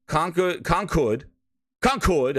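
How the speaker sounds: New York accent. Shown as a demonstration. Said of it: "I know my New York accent".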